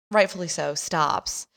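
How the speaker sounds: usual speaking voice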